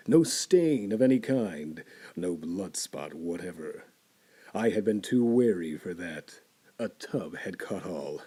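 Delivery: ordinary delivery, nothing marked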